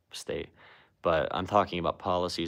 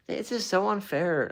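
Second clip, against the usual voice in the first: put-on much higher, slow, whining girl voice; whiny little-girl Trump; 11 semitones higher than usual. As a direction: whine like a sulky little girl. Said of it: "he does sound like a baby, like a little girl".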